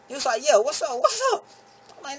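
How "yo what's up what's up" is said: very high, startled voice; jolted awake, high and sudden; high startled squeak; dazed cousin. Retold or imitated. Cousin snapping out of daze.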